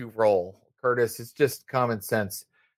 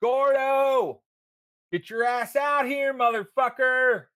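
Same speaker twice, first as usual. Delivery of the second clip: put-on drunk yelling from the street; 12 semitones higher, slower than usual. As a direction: yell for him to come out, drunk and rowdy.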